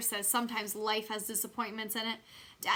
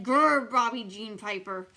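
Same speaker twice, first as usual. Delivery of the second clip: louder, slow mock growl; 8 dB louder, slower than usual. A narrator's reading. Junie B growling at a mean girl, playful mock growl. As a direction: growl at her to look scary. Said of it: "I made a scary face".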